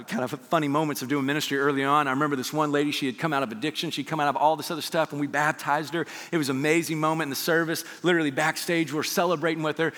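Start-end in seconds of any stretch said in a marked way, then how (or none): none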